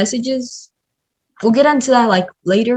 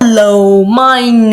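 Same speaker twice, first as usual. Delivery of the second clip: edited playback; 8 dB louder, slower than usual. Shown as a demonstration. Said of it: "We can also make it faster".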